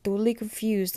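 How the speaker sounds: ordinary talk, nothing marked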